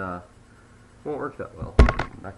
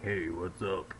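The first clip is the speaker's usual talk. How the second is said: bad talking voice; 3 semitones lower, slower than usual. Shown as a demonstration. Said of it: "make your voice, like, sound bad when you talk".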